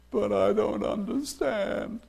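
puzzled Daniel. Acted. Daniel protesting to God that he doesn't understand, soft and slow. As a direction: plead your confusion gently and slowly.

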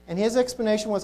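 ordinary delivery

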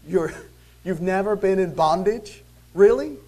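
loud, high disbelieving question; sarcastic Really, rising high and loud; incredulous mock. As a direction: scoff at the claim in disbelief.

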